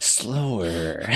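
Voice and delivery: mock creepy order. Echoes the cat pimp order.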